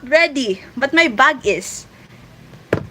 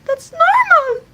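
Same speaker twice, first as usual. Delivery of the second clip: much higher, slow, stretched-out squeal; mock squeal; 6 dB louder, slower than usual. Reassuring a viewer their weird childhood is fine, in a slow high squeal.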